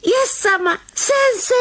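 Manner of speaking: very high, rising cry of recognition; Mary crying out on recognizing Jesus; joyful cry. Acted. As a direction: call out in sudden joy.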